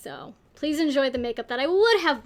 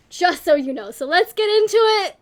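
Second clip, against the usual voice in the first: usual speaking voice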